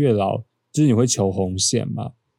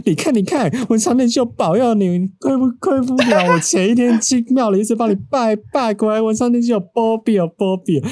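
much higher, eager mother gushing; excited mom imitation; 12 semitones higher, 3 dB louder than usual. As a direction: mimic the mother bursting with excitement over the grade. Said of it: "她就突然很兴奋过来说".

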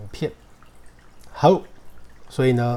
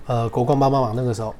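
normal talking voice

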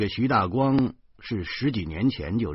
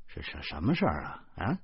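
hushed, wary question; 4 semitones lower, 7 dB quieter than usual. A narrator's reading. Cautious question in the toilet.

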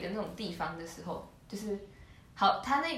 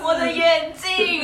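high, very slow fake sob, louder; mock crying kid; 8 semitones higher, 11 dB louder, much slower than usual. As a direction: wail it like a whiny child, half laughing. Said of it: "joins in mocking the wail".

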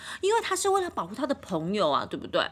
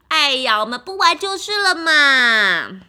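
placating whine; 3 semitones higher, 10 dB louder than usual. Acted. Piggy placates angry duck.

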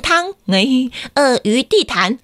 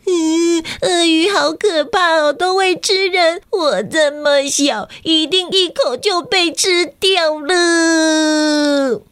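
put-on high, slow, trembling little-boy voice; a frightened small child, almost in tears; scared little boy; 6 semitones higher, slower than usual. Storyteller voicing the scared little boy Kakai to kids. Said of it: "心里还想说".

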